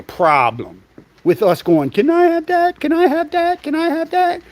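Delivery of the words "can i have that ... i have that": put-on high pleading kid voice, nagging over and over; mock whiny child. Kid nagging his mother in the store, in a high begging whine. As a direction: imitate a little kid begging for a toy.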